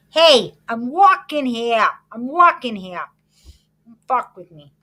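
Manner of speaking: slow, loud mock New York street accent, leaning on "walking"; a hammed-up New Yorker yelling at traffic on "I'm walking here". A guy from Western New York mocking the city accent, loud and slow. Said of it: "that New York, like, you know".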